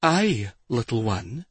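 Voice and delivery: lightly voiced silky purr; a hint of a purring villainess. A narrator's reading. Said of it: "the woman asked in a silky purr".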